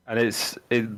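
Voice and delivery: ordinary talk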